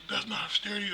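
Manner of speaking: high, fast mock girlfriend voice, half-whispered; offended girlfriend. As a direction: squeak it out like an indignant girlfriend. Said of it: "She goes".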